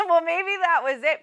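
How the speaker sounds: bursting into a real laugh mid-word. Real, not acted. Medium amused by the dead father's lawn joke, laughing.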